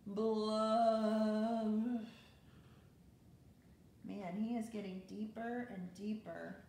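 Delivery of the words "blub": loud underwater fish blub. Acted. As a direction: blow a fish bubble sound into the mic. Fish bubble sound in story.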